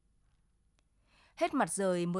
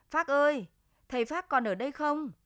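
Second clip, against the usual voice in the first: loud call from outside, higher; calling out loudly; 5 semitones higher, 5 dB louder than usual. Acted. The older man calls out for Phác from the doorway, loud. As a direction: shout the name to find him across the house.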